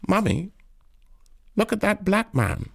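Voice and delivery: put-on a little kid tugging at mummy; little child. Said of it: "A little child holding on to the mother's hand".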